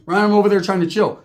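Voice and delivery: normal talking voice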